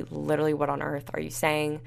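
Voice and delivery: ordinary talk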